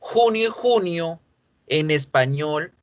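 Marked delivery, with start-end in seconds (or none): 0.1-1.2 "Junio" with a throat-friction j, higher; "junio" with a strong Spanish jota. Shown as a demonstration. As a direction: model "junio" as khunio. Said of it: "yo las hago con J".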